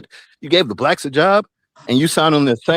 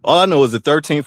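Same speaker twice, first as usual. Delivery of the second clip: ordinary delivery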